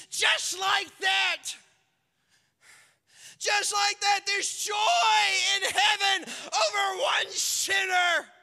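high, loud, slow preaching climax; preaching at full force. Acted. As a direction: drive it home with fervour.

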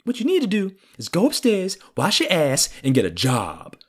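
high nagging voice, sliding down to the end; annoying stepdad. Retold or imitated. Stepdad ordering a teenager about, annoying nag. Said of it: "but he was annoying".

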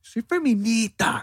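very high, drawn-out parody; hammed-up feminist declaration; mock feminist. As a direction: stretch it out in a high, indignant whine. Repeats the offended feminist parody.

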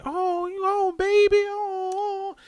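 very high, drawn-out mock cooing; fussing grandma. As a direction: imitate a doting relative, way up high. Imitates family fussing over drunk relative.

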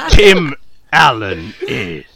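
talking through real laughter; laughing. Real, not acted. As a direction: laugh while you speak. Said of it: "I kinda lost it".

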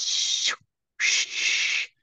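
soft, voiceless "shh" static; imitated phone static. Storyteller mimicking the phone answering her question, static hiss. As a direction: hiss like a crackling ghost app. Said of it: "her phone goes".

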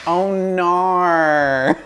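high, loud Australian "Oh, nar"; Australian accent. Shown as a demonstration. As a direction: belt out an Aussie "nar". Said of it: "It's my favorite word".

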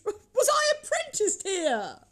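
very high, drawn-out exclamation; slow, high-pitched wonder; delighted recognition. A narrator's reading. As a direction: cry out in joyful amazement. Scrooge recognises his old workplace.

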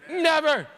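Never hit high and loud; a single, forceful Never; emphatic never. Real, not acted. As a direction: punch Never with a falling close. Emphatic point in sermon.

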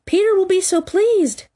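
high, shrill fairy squeal; Tinker Bell. A narrator's reading. Tink eggs Tootles on to shoot, shrilly. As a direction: squeal it high with eager glee. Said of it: "she screamed".

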